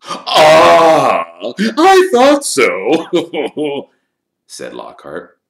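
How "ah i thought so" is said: high, loud, drawn-out self-satisfaction; pompous know-all. A narrator's reading. Lockhart claiming he knew all along, pompous and loud. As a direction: draw it out grandly.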